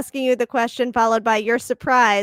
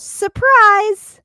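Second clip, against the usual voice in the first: loud, high "Surprise!" burst; all-caps shout; 9 semitones higher, 10 dB louder than usual. Retold or imitated. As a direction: yell it like the all-caps text. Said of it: "in all caps, it goes".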